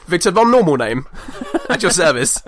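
hammed-up, laughing self-introduction; mock-innocent introduction. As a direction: introduce yourself grandly under a silly alias. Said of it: "And it's like".

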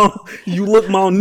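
lightly voiced [name] rap line; loud mock [name] line. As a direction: punch it like the rap opener. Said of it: "He was like".

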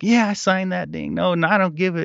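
high, mocking voice of the dismissive mother; mocking mom. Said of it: "I could hear her on the phone".

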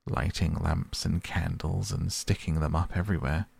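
usual speaking voice

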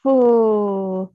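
long audible ooh on the out-breath; exhaling ooh. Shown as a demonstration.

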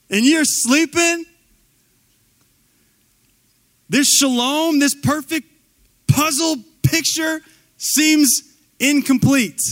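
loud, high, drawn-out indignation; acted outrage. Acted outrage at a sleeping Jesus.

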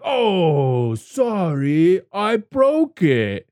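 high, slow, sing-song fake apology; mock fake apology. Biker taunting the little clown after breaking his bike, in a high, slow mock apology. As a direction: drag out a falsely sorry "I broke it". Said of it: "And he's like".

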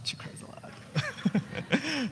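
slow, breathy stage whisper of She cries a lot. Shown as a demonstration. Pastor teasing about his wife to the church, slow whisper. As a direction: lean in and whisper it like a secret.

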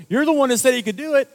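loud, high, fast mock outburst; imitating an exasperated elder; retold accusation. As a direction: throw the blame back, high and rapid. Retold elder blaming the speaker.

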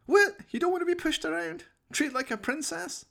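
high sing-song taunt; mock teasing. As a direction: tease him like a fussy princess. Jones mocking the hesitant chimp.

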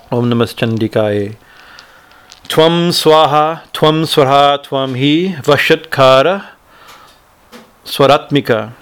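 slow, devotional chanting of the verses; Sanskrit hymn recitation. Acted. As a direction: intone each line like a prayer.

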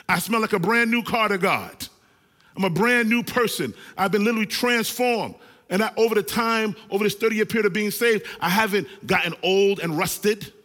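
loud, fired-up preaching to the congregation; preaching at full force. Acted. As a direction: call out to the church with fire.

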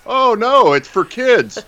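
high, sing-song mock whine; mocking whiny excuse. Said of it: "this cop-out of".

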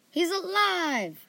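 high, loud, drawn-out cheer; cheerful. A narrator's reading. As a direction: cry it out cheerfully, stretching it. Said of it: "Fan said cheerfully".

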